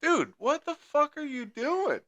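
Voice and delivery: high, drawn-out mock outrage; retold indignation. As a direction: confront the driver in exaggerated disbelief. Retells confronting the other driver.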